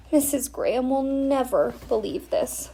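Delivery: moaning. Acted. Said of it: "Stella moaned".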